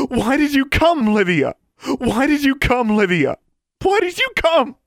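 loud, high-pitched anguished plea; crying. A narrator's reading. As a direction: wail the question with growing desperation. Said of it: "her mother was crying".

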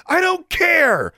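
high, loud, slowed mother's yell; shouted back. A narrator's reading. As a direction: lose your temper at the toddler. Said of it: "Claudia shouted back".

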